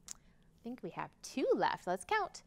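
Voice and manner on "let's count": ordinary delivery, nothing marked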